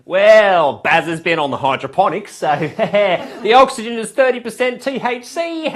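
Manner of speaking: put-on Australian accent.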